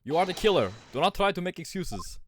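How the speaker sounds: high, forceful mock Russian accent; terrible Russian accent. Sailor accusing the prisoner of murder, terrible Russian accent. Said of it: "all getting this fucking terrible Russian accent".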